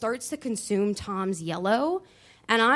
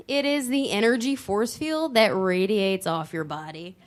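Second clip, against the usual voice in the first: usual speaking voice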